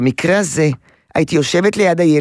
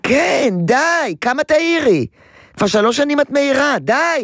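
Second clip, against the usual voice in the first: higher, drawn-out fed-up protest; exasperated enough already; 9 semitones higher, slower than usual. Pretend. Therapist voicing the child telling mother to stop nagging, fed-up. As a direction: stretch out a weary fed-up cry of enough.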